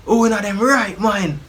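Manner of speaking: imitating an outraged father, pitched high; high-pitched, hammed-up parental outrage; angry dad. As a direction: play dad confronting his son's bullies. Acts out dad confronting the boys.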